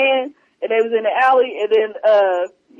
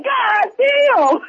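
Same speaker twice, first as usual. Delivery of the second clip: high shouted God damn in mock shock; 11 semitones higher than usual. Retelling Smokey seeing her pull her wig off, a high mock shout. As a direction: yelp it out, hammed-up shock.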